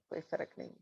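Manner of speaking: hushed whisper on کوئی فرق نہیں; whispered answer. Real, not acted. Teacher answering her class in a whisper. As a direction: drop to a whisper to drive it home.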